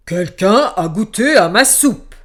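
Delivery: loud booming bear voice; big bear voice. A narrator's reading. Big bear looking at his bowl, loud booming voice. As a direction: boom it out like a giant bear. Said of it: "regarda son bol et dit".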